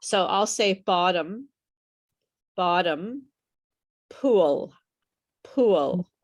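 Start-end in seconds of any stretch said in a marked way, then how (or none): 0.9-3.3 "bottom" said clearly, lips closing on the final m; model pronunciation. Shown as a demonstration. Teacher has students listen for a difference, careful model. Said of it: "So listen to how I say it". 4.1-6.1 "pool" said strongly with a full final l; a loud, clear model "pool", l fully sounded; model pronunciation. Shown as a demonstration. Teacher has students listen for a difference, clear model. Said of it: "So listen to how I say it".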